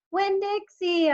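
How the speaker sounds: high, loud, slow screaming call; screamed. A narrator's reading. Crying girl screaming for her dog. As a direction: scream his name into the rain. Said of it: "I screamed".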